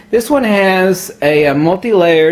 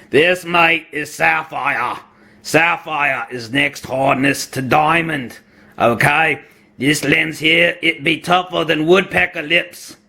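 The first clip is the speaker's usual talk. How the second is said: hammed-up Aussie accent, lower and drawn out; Australian accent; 2 semitones lower, 3 dB quieter than usual. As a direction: play a mock Australian tough talker. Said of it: "my use of an Australian accent".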